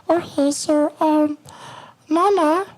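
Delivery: very high coaxing inner voice; high calming voice. Retold or imitated. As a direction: soothe yourself in a squeaky high voice. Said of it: "this is my voice that I use to calm myself down".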